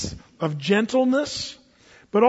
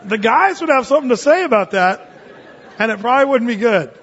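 louder and higher, laughing through the words; real laughter while speaking; 4 semitones higher, 6 dB louder than usual. Real, not acted. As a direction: chuckle your way through the punchline. Laughing at his own joke.

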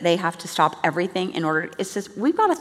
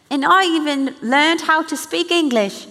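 put-on higher, louder playful Australian accent, slow on "Tell you what"; 9 semitones higher, 6 dB louder, slower than usual. Quoting the Australian wife from the video, fond Australian accent. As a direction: mimic the Aussie wife's proud English in an Australian accent. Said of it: "She still had a little Australian accent".

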